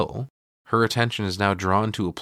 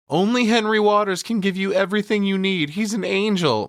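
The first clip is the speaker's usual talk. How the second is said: higher, raised mock cheer; mock yelling; 10 semitones higher than usual. Wife hailing her husband to the crowd, mock yelling. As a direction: sell it like a hammed-up devoted wife. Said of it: "his wife starts yelling".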